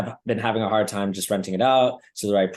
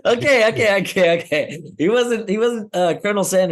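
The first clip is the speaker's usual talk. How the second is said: laughing protest; 8 semitones higher, 3 dB louder than usual. Real, not acted. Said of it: "laughing protest to friends' teasing".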